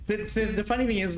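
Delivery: ordinary talk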